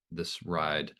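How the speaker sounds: usual speaking voice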